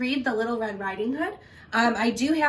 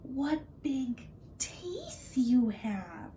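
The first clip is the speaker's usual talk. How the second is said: slow frightened whisper; scared girl hushing; 3 semitones higher, 6 dB quieter, much slower than usual. Acted. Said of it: "Little Red whispered".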